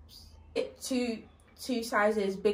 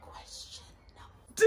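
slow whisper; slower than usual. Shown as a demonstration. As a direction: whisper the question slowly. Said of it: "like that".

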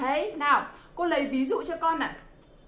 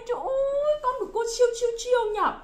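very high, cooing amazement; gushing praise; 10 semitones higher, faster than usual. Pretend. Teacher gushing over her little pupil, delighted praise. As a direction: coo high over a brilliant child.

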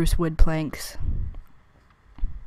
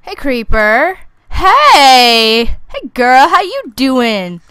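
mock flirty greeting; 5 semitones higher, 9 dB louder, much slower than usual. Flirty taunt to creeper mob.

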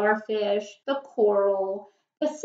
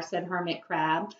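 usual speaking voice

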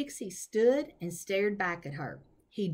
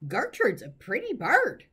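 raised, squawky parrot chatter; mild bird screech; screaming parrot; 4 semitones higher, 5 dB louder than usual. Acted. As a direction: call it out high and loud like a parrot. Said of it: "screamed Gertrude".

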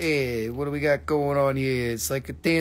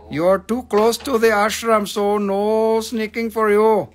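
put-on higher, loud accented scolding; imitated spiritual elders; 5 semitones higher, 4 dB louder, slower than usual. Spiritual people warning huggers near the ashram, imitated scolding. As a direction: scold with a long drawn-out ending. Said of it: "by the spiritual people".

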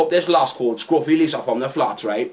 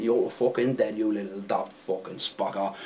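much lower and softer inner rage; muttered threat; 8 semitones lower, 10 dB quieter than usual. Retold or imitated. Driver silently threatening the taxi kid, muttered low and soft. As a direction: seethe it under your breath. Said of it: "I'm thinking".

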